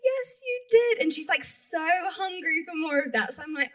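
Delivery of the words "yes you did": high, excited confirmation; gleeful agreement, pitched right up; delighted retold yes. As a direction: affirm it with thrilled pride.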